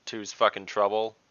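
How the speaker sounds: usual speaking voice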